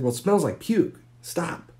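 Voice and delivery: high, loud, fed up; exasperated yell. Retold or imitated. Wife scolding her vomiting husband, loud. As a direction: snap it at him. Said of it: "She's like".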